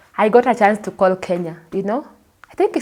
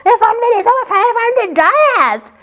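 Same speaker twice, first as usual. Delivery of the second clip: high, loud outraged shriek; shrieking at the huge phone bill; angry complaint; 6 dB louder than usual. Retold or imitated.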